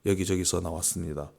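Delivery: usual speaking voice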